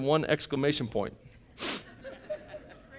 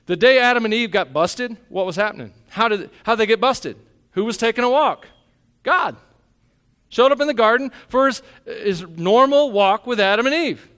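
louder and higher, driving the point home; full-force preaching; 8 semitones higher, 8 dB louder than usual. Acted. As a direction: preach it hard to the congregation. Preaching climbs again.

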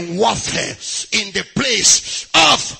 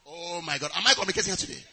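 usual speaking voice

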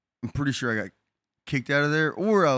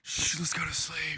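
hushed; 3 semitones higher, 9 dB quieter than usual. Retold or imitated. Hushing sleeping roommate.

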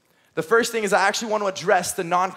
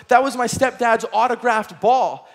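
put-on slow, worried kid confessing; 4 semitones higher, 4 dB louder, slower than usual. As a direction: own up to the disaster, slowly. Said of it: "He goes".

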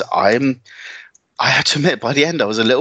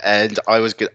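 ordinary talk

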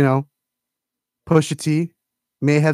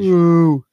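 loud drawn-out boo; crowd booing; 4 semitones higher, 6 dB louder than usual. Retold or imitated. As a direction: boo him off stage. Crowd booing [name], retold.

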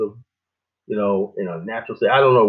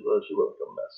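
usual speaking voice

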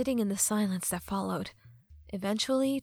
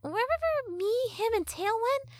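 high-pitched sassy fairy; Tattle fairy voice; 12 semitones higher than usual. Acted. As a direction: play a cheeky fairy girl, high.

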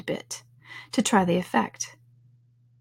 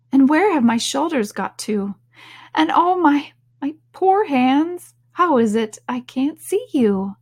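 higher, louder puzzled wailing; Alice, alarmed; 6 semitones higher, 4 dB louder than usual. Acted. Alice searching for her shoulders and hands, dismayed. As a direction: lament it in raised, dismayed questions.

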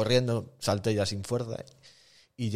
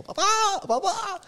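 much higher mock scream of "papá"; girl screaming for dad; slower than usual. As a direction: imitate the frightened girl calling her father, pitched way up. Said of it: "lo arrastró y dijo". (imitando a la chica gritando "papá", muy agudo)

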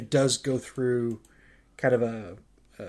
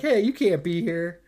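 mildly indignant, raised in pitch and level; 5 semitones higher than usual. Retold or imitated. Mook confronting the intruders, retold with mild indignation. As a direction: imitate an affronted man objecting. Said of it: "is indignant".

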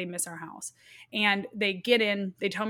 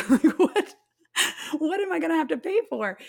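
higher, louder mock-panicked mom over the bill; hammed-up money worry, much higher and louder; laughing exasperation; 9 semitones higher, 7 dB louder than usual. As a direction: laugh through a hammed-up what. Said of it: "as a mom, my default was".

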